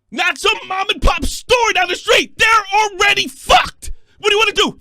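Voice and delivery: host ranting to the audience; angry yelling. Real, not acted. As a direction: hammer the point home. Host yelling his point.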